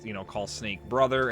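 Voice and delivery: usual speaking voice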